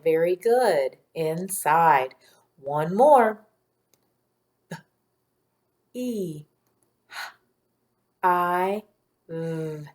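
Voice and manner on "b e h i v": B, E, H, I, V as separate sounds; one sound at a time, long pauses between. Shown as a demonstration. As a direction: phonics segmenting for blending. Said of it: "I'll say the sounds".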